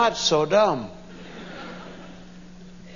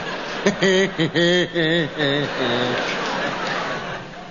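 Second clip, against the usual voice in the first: low, drawn-out henchman chuckle; fading mock-evil laugh; henchman cackle; 4 semitones lower than usual. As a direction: cackle slowly like a villain's servant. Henchman's cackle after yes, master.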